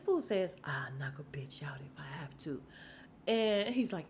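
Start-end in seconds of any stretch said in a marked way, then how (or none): 0.6-2.6 low, whispery imitation of a tough guy; mock-menacing whisper; hushed threat. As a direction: mutter the threat under your breath, mimicking him.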